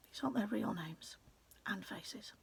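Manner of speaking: hushed, secretive aside to the reader; conspiratorial whisper. A narrator's reading. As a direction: whisper it like a secret only we share.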